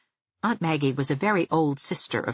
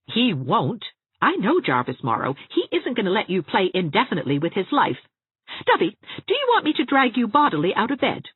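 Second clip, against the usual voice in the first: higher-pitched scolding, building to a threat; exasperated Anne, stern; 7 semitones higher, 4 dB louder than usual. A narrator's reading. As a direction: lose patience with her, pitch climbing.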